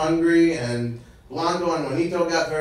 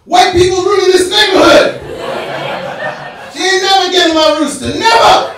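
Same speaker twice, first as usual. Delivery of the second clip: furious shout; 12 dB louder than usual. Retold or imitated. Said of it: "He's like".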